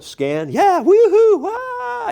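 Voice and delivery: high elated woohoo; whooping. Retold or imitated. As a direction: cheer in free fall. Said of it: "They went".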